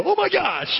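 high-pitched mock dismay; mock shocked reaction. Imagining his friend seeing the bulletin, mock shock. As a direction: react as if scandalized, playfully. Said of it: "and watch him go".

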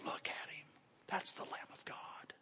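hushed, whispered proclamation; reverent whisper. Acted. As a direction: whisper it to the church in awe. Quotes John pointing to the Lamb.

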